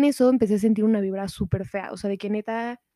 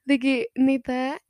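usual speaking voice

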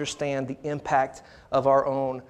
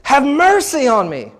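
high, slow full-voiced shout; crying out; 9 semitones higher, 9 dB louder, slower than usual. Preacher voicing the blind beggar's plea to Jesus, crying out. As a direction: cry it out with heavy emphasis. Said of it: "he cried it out".

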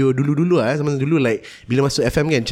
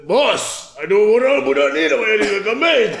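laughing call; 7 semitones higher than usual. Acted. Calls to boss laughing.

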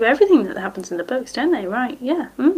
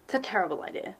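normal talking voice

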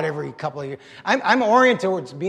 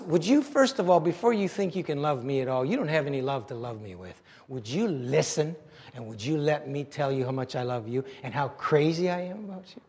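soft, low, intimate appeal; tender pleading; 5 semitones lower, 9 dB quieter than usual. Acted. God asks the listener to hear his love, softly. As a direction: ease down into a gentle, loving invitation. Voices God's tender plea.

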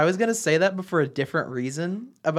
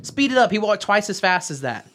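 high, raised-voice reenactment; shouted stage line; 5 semitones higher than usual. Retold or imitated. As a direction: call out the line abruptly, as if on stage. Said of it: "and then I shout".

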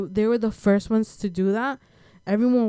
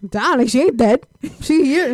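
loud exclaim; 5 semitones higher, 6 dB louder than usual. Retold or imitated. Mock exclaiming about mom.

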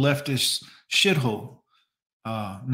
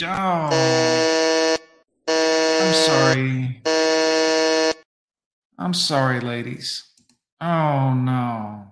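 mock sympathy; 4 dB louder, much slower than usual. Mock condolence to older ladies.

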